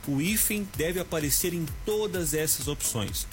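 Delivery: ordinary delivery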